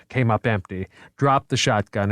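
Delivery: usual speaking voice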